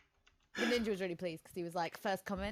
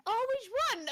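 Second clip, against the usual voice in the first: high, louder mock-interested question; pitched-up, eager imitation; 14 semitones higher, 5 dB louder, slower than usual. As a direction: pipe up keenly as an imitated bystander. Acting out the man's eager question.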